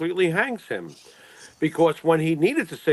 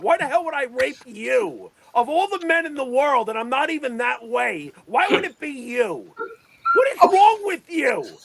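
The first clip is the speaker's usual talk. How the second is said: mock indignant; 6 semitones higher than usual. Mock outrage at hypothetical.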